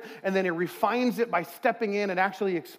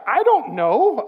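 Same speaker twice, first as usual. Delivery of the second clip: helpless shrug; 6 semitones higher, 8 dB louder, much slower than usual. Retold or imitated. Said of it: "I'm just kinda like".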